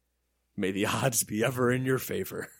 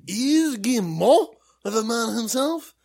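bad French accent; 8 semitones higher, 6 dB louder than usual. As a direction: do a botched French accent, half Eastern European. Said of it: "Why is my French, like, half angry Eastern European?".

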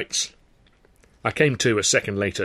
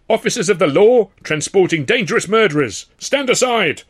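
guard's proclamation; 9 semitones higher, 7 dB louder than usual. A narrator's reading. Guard announcing to crowd.

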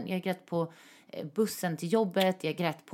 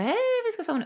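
much higher, sing-song innocent voice; innocent reply; much faster than usual. Retold or imitated. Replying to her ex's friend, hiding guilt, in a much higher sing-song voice. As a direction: chirp it like nothing is wrong. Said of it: "Så jag bara".

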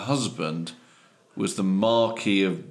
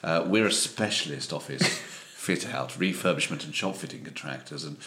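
put-on low, soft posh English accent; quietly well-spoken, posh businessman accent; posher accent; 5 semitones lower, 6 dB quieter than usual. Company owner phoning Tim, soft posh accent. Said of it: "I could do slightly posher than myself".

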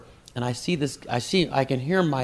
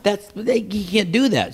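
much higher, louder outraged objection; indignant protest; 10 semitones higher, 4 dB louder than usual. Retold or imitated. Comic protesting to the club owner, high and outraged. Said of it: "I said".

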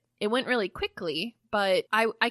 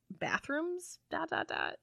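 mock puzzled; 7 semitones higher, 8 dB quieter than usual. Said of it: "And then I was like".

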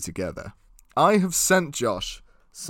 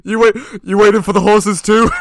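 higher, louder comic stranger; fellow hider; 5 semitones higher, 9 dB louder, faster than usual. Acted. Hider in the hay greeting a newcomer, raised comic voice. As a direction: blurt it as the surprised man already hiding. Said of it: "there's somebody else in there".

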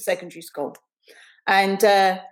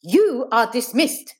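slow, stiff teacher's order, raised pitch; stiffly dismissing a class; 5 semitones higher, slower than usual. Acted. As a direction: space out each word formally. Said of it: "Miss McHinton said stiffly".

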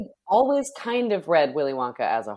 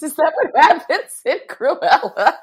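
incredulous; 10 semitones higher, 7 dB louder than usual. Real, not acted. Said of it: "That's so silly".

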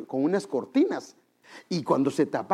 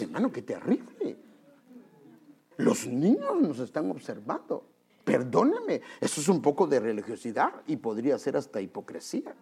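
normal talking voice

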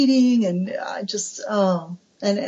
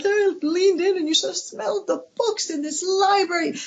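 gushing to daughter; 10 semitones higher than usual. Retold or imitated. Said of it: "I'm like".

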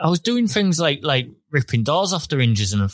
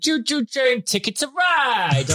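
talking rock; 10 semitones higher than usual. Retold or imitated. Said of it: "Oh, the rock voice".

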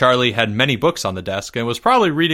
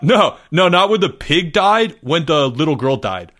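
high, loud scrambling correction; bursting out; 5 semitones higher, 4 dB louder than usual. A narrator's reading. Boy correcting his mistake to the class, bursting out. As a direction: blurt it out, high and loud, easing toward the end. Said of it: "Greg burst out".

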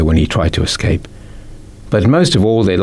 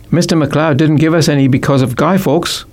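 put-on higher eager child voice; lightly voiced boy; boy's reply; 8 semitones higher than usual. A narrator's reading. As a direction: pipe up like a schoolboy. Boy answers his mother.

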